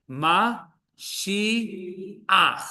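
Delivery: "Ma shi ach" drawn out beat by beat; very slow syllables. Shown as a demonstration. Hebrew teacher stressing a key word, very slow. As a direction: carefully spell out each syllable. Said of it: "This is a really important one".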